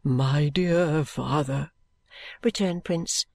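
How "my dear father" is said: very slow, earnest address; dutiful son. A narrator's reading. Son appealing to his father, devotedly. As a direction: draw it out humbly.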